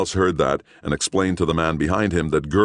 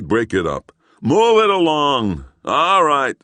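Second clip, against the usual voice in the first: loud high call, slowed on move it along; policeman ordering; 11 semitones higher, 6 dB louder, slower than usual. Acted. Policeman ordering the crowd to move along. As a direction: herd the crowd with authority.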